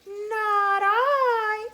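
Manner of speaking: high, loud meowing whine; whiny cat. A narrator's reading. Cat refusing to help the hen, high whiny voice. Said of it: "said the cat".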